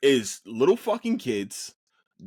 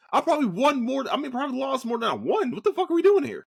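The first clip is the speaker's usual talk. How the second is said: angry rant; 11 semitones higher than usual. Real, not acted. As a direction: vent the frustration, voice climbing. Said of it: "I'm just angry".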